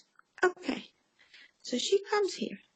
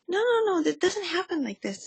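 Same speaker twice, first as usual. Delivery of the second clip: retold firm protest; 7 semitones higher than usual. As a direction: cut in with a retold "no way", easing off after. Acted rebuttal to her friend.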